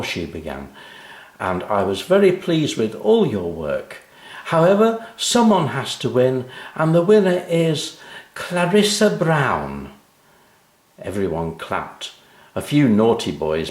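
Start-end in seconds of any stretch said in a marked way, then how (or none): 1.4-9.9 high, announcing teacher. Acted. Teacher voiced in story.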